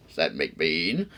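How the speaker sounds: ordinary delivery, nothing marked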